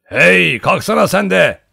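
high, full-voiced wake-up shout; loud, boisterous cry; hollering. A narrator's reading. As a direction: holler to rouse a sleeper. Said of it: "diye haykırdı".

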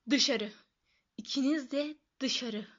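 slow, rasped command to get out; growled order. A narrator's reading. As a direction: growl it slowly, holding back fury. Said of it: "diye hırıldadı". (hırıldayarak)

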